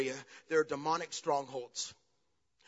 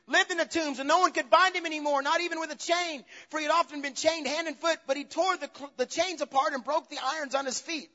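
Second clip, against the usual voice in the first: high-pitched, driving Bible reading; forceful scripture preaching; 12 semitones higher, 6 dB louder than usual. Acted. Preacher reads congregation the chained man's story, forceful preaching. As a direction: declaim the verse with urgency.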